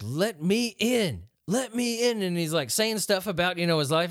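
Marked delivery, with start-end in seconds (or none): 0.0-2.2 slow, drawn-out pleading; lightly voiced ghostly old man begging at the door; creepy priest imitation. Podcaster imitating the Poltergeist priest at the door, slow and eerie.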